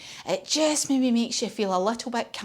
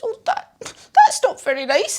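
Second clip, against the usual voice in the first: higher voice, words squashed by a knotted trunk; trunk-tied elephant; 9 semitones higher than usual. Acted. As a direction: speak as if your nose is tied up. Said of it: "he can't say it very easily because his trunk's in a knot".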